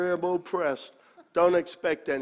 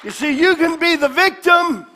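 loud, high, shouting preacher; preaching at full force; 9 semitones higher, 9 dB louder than usual. Acted. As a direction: build it to a roar.

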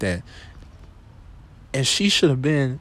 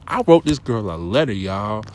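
ordinary talk, nothing marked

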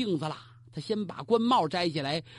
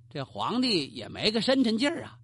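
plain reading voice

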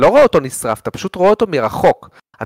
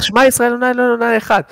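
imitated screamed prayer; 9 semitones higher, faster than usual. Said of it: "והוא צורח".

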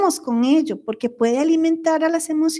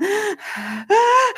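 a high, choked sob; imitated sobbing gasp; 8 semitones higher than usual. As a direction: gasp as if starting to cry. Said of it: "Y entonces yo lloraba". (sollozo imitado, agudo y ahogado)

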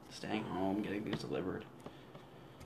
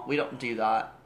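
mock British accent; 6 dB louder than usual. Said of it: "in a British accent".

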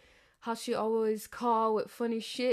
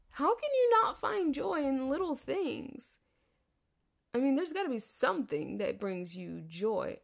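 ordinary delivery

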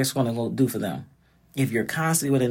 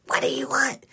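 Lois's reply. Retold or imitated. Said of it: "They'll be like".

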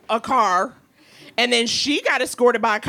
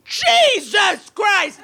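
loud, drawn-out, crying exclamation; mock scream; 9 semitones higher, 4 dB louder, much slower than usual. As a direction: wail it slowly like a shocked woman. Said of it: "And then she goes".

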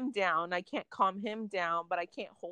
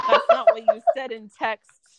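laughing retort; 6 semitones higher, 10 dB louder than usual. Retold or imitated. Retelling a quarrel with baby dad.

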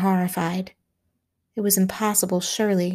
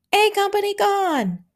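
much higher, yelled report; frantic shout; 13 semitones higher than usual. A narrator's reading. Signal station shouting losses in the pandemonium, frantic yell. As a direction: cry out the loss in panic.

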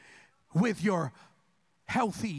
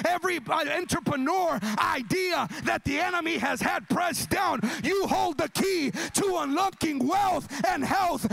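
raised, driving preacher's call; prophetic declaration; 8 semitones higher, 5 dB louder than usual. Real, not acted. Preacher declaring breakthrough to the congregation, raised preaching. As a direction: declare freedom over the room with rising force.

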